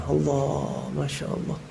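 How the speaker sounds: soft, breathy-free awed murmur of Masya Allah; awed hush. Real, not acted. Preacher recalling the teacher reading his heart, hushed awe. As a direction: let it drop almost to nothing in awe. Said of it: "Kaget tuh anak".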